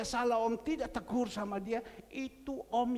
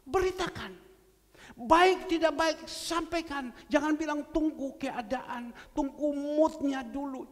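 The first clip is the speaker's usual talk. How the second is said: fervent preaching; 6 semitones higher, 5 dB louder than usual. Acted. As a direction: preach it with rising force. Preaching at full force.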